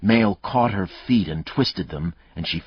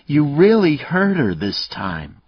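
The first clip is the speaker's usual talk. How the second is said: about to bawl; 10 semitones higher, 7 dB louder than usual. Acted. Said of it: "she began to bawl".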